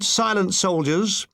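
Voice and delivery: plain reading voice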